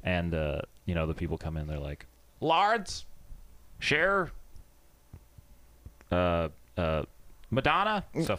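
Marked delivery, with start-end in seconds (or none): 2.4-8.0 lightly imitated high-pitched name call. Receptionist to waiting patients, mock calling out names.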